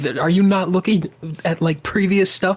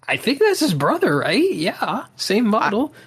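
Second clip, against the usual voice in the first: high-pitched mock clueless voice; clueless writer; 7 semitones higher than usual. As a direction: imitate someone guessing carelessly, pitched up. Voicing a careless trophy writer.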